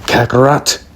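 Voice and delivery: mild mock shout of a name; retold shout. Raditz calling out to his little brother, mild mock shout. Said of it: "Raditz is shouted".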